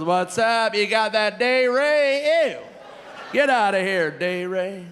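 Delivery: high, loud voice with drawn-out words; hammed-up mock quote. Mocking heckler-style quote opening the bit.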